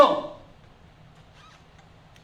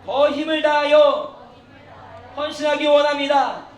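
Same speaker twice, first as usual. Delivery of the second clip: very loud, slow, raised declaration; leading repeat-after-me prayer; 4 semitones higher, 10 dB louder than usual. Shown as a demonstration. Teacher leads students in a vow, loud slow proclamation. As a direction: proclaim each phrase for the room to echo. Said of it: "다시 한번 같이 따라합시다".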